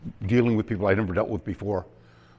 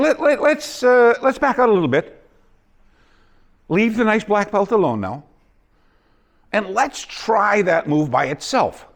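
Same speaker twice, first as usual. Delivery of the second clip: calling the class back; 9 semitones higher than usual. Retold or imitated. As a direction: rein in the class, half laughing. Instructor calls class back.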